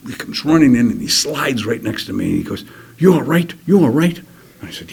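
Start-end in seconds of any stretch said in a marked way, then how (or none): 3.0-4.2 high, loud urgent question; retold soldier's anxious, raised-voice check. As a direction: call out urgently to a wounded buddy. Soldier rushes in to wounded man.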